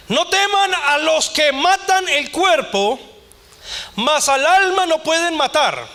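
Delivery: slow, high, solemn scripture proclamation; preaching. Acted. Pastor proclaims scripture to the congregation, slow and solemn. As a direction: proclaim each word slowly from the pulpit.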